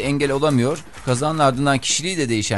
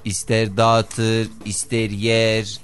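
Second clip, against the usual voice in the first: slow, each word stretched out; drawn-out emphasis; much slower than usual. Real, not acted. Telling grooms the bride does as she likes, drawn-out. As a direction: spell it out slowly, word by word.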